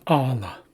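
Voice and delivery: pala opened with a popped ejective P. Shown as a demonstration. Teacher reads out a Haisla word for learners, ejective P. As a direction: say "pala" with a sharp ejective P at the start. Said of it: "what we call, uh, ejective Ps".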